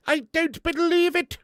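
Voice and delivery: high, loud, drawn-out catchphrase impression; Victor Meldrew. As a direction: stretch it out in exasperated high-pitched disbelief. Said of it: "is it Victor Meldrew".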